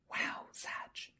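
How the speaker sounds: breathless awed whisper. Real, not acted. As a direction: hush it out in wonder.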